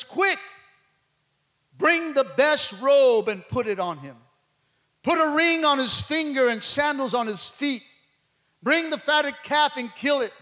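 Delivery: loud, high, exultant preacher; ringing celebratory scripture delivery; full-force preaching. Acted. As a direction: proclaim the father's orders with rising force.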